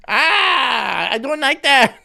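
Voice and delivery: put-on high loud Filipino-accented outburst; comic Filipino accent yell; mock Filipino accent. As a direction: shout it in a hammed-up Filipino accent. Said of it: "He would have to do the accent".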